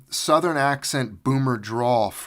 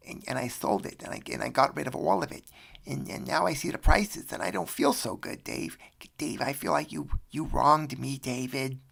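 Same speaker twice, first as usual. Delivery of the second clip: plaintive put-on caller, rising in pitch; mock-hurt, hesitant complaint; [name]; 3 semitones higher than usual. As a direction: play a wronged caller, faltering over words.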